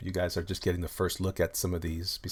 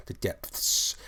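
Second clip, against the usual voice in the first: whispered echo, hissing ending; breath-only, drawn-out final word; spooky whisper. Acted. Dramatic echo of last phrase.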